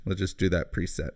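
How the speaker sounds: ordinary talk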